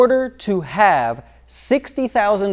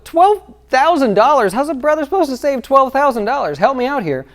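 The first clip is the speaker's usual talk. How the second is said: exasperated viewer; 8 semitones higher than usual. Retold or imitated. Viewer balks at the savings target, incredulous and pleading. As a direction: play the overwhelmed saver pleading for help. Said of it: "You go".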